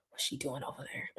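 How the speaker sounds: a nosy whisper; whispered snooping. Retold or imitated. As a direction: whisper it like someone spying. Bots coming back, snooping.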